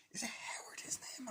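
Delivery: whispered to himself; whispered aside. Real, not acted. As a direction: mutter the question under breath. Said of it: "I wanna just look up".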